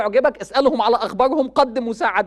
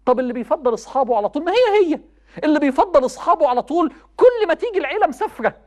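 raised, pitched-up sermon voice; impassioned preaching; 4 semitones higher than usual. Acted. Preacher urging young people, impassioned. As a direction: preach it high and urgent to the crowd. Preacher warns youth about mothers.

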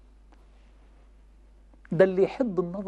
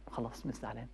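much lower, barely audible resigned murmur; grudging acceptance; 11 semitones lower, 20 dB quieter than usual. Acted. Child forcing a smile at parents, grudging murmur. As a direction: give in under your breath.

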